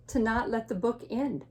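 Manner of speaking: usual speaking voice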